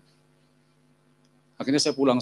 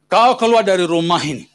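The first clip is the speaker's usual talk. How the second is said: loud acted father's order; furious retold command; angry banishment; 3 semitones higher, 6 dB louder than usual. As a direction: throw the son out with force. Father throws son out, acted.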